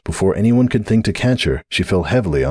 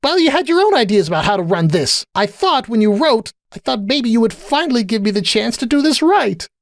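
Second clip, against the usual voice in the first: high, urgent plea; pleading. A narrator's reading. Sunburst wanting to do right, high pleading. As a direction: implore in a raised pitch.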